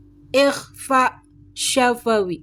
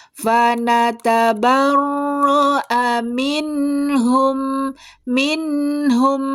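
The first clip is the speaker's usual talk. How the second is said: Quran recitation; 2 semitones higher, faster than usual. Shown as a demonstration. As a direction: draw out each nasal hum, measured. Teacher recites the verse slowly.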